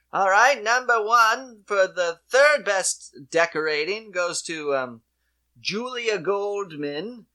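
loud, high announcer call; contest announcer. Retold or imitated. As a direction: call out the winner like an emcee. Announcer calls out winners.

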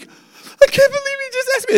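very high squeaky imitation of crying; mock tearful. Imitating a lady in tears when offered prayer, high mock-weepy squeal. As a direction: squeak it out like someone bursting into tears. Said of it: "Burst into tears. She's like".

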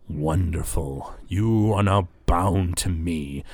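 slow, savoured pronouncement; Zalaman the Wretched. Acted. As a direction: draw each word out as a binding claim. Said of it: "he says".

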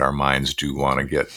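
ordinary talk

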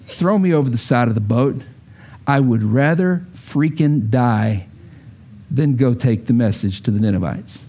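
just a man who would rather die; adult reading of Jonah. Retold or imitated. Jonah read like an adult, plain and grudging. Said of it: "Read it like an adult".

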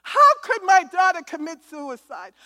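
loud, high anguished cry out to God; retold scream. Mother screaming to God over her daughter's death, retold scream. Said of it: "I started screaming".

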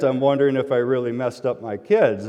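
normal talking voice

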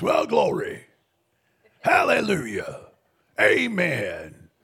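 low gravel growl, loud and rough; gravelly preacher voice. Retold or imitated. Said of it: "He's got a gravelly voice".

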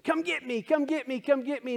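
put-on high-pitched child begging to be chased; playful, squealy little-kid chant; little girl. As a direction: imitate an excited kid, voice way up. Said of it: "My cousin's little girl was saying".

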